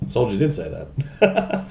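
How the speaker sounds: slow gruff soldier reply. Retold or imitated. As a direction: answer back as Soldier. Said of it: "say things in Soldier's voice".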